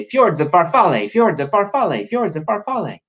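high, singsong repeated phrase; playful tongue-twister. Shown as a demonstration. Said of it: "booksellers at story times have to say".

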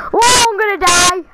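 loud, panicked cry of falling; panicked shout. Real, not acted. Player falling in the game, panicked shout. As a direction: yell as if about to crash.

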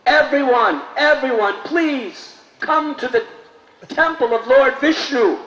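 high, loud call to the crowd; calling out loudly. Acted. Ramanuja calling the townspeople to the temple, loudly. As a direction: call everyone from afar. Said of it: "he called out very loudly".

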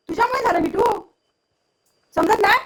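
high-pitched reproach to the parrot; pet scolding. Real, not acted. Scolding parrot after burning hand. (उंच सुरात लाडिक रागावणे)

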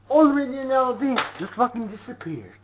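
usual speaking voice

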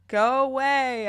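grumpy yell at the bedjumpers. A narrator's reading. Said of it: "I yell".

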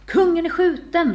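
high, loud, slow cry; drawn-out shout of alarm. A narrator's reading. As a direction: call out the news to the whole room.

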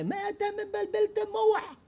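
high pitched angry yelling at Ronen; high exasperated neighbor's yell; mock yell. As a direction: shout him down, fed up. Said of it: "שעה צעק עליי".

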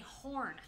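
hun rounded out into horn; Baltimore "hun". Retold or imitated. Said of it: "they don't say hun, they say horn".

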